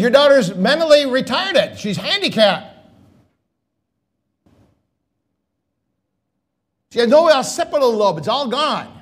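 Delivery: doctor leaning over the desk, stating the verdict bluntly. Retold or imitated. Blunt doctor delivers harsh diagnosis.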